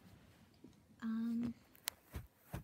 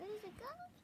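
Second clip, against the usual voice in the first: high squeaky question; 11 semitones higher, 5 dB quieter than usual. Shown as a demonstration. Repeating phrase in varied tunes.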